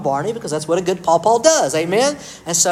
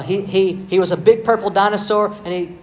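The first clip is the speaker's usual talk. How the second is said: ordinary talk, nothing marked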